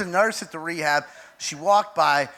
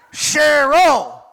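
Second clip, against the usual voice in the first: loud, higher-pitched holler of a name; acted yell; 7 semitones higher, 8 dB louder than usual. Roommate hollering at a woman cruising by, acted yell. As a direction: yell out across the room. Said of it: "Pootie Twang yells out".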